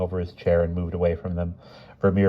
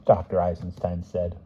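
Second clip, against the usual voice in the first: ordinary delivery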